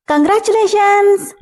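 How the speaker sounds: spring the surprise with a cry; high excited surprise shout; sudden loud shout. A narrator's reading. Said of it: "అని గట్టిగా అరిచేసింది ఒక్కసారిగా".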